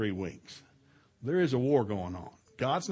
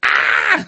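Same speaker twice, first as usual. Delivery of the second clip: loud mock tantrum yell; kid's tantrum scream; 9 semitones lower, 16 dB louder than usual. Preacher imitating a woman kicking on the floor, tantrum scream. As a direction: scream out like a kid throwing a fit. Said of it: "throwing a fit, like a kid".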